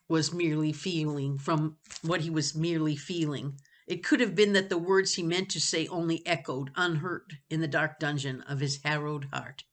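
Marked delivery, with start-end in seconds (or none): none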